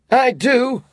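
high, loud clipped reply; curt retort. A narrator's reading. Scrooge answering his nephew, curt and cross. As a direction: snap it back.

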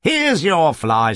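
slow mock shout. Cab driver shouting to the Tuggs family, mild shout. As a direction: call it out slowly. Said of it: "shouted another aspiring charioteer".

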